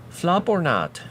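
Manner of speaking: "Flop or not" repeated high and sing-song; light high sing-song "Flop or not"; playful high echo. Real, not acted. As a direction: toss "Flop or not" back up high like a tune. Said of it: "I should let him flop or not".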